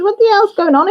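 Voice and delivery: high, loud mock-alarmed demand; exasperated retold outburst. Retelling to viewers how she demanded answers from Annie, loud and high. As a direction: blurt it out like you've just found the quiz gone.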